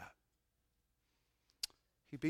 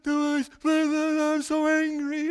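loud, high, slowed, broken-up outburst; anguished cry; 14 semitones higher, 17 dB louder, slower than usual. Retold or imitated. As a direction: cry it out in pieces. Retold anguished cry to wife.

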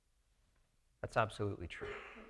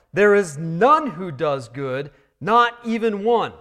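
loud proclamation; 5 semitones higher, 9 dB louder than usual. Acted. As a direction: hammer it out like a proclamation. Proclaiming gospel truth, Romans three.

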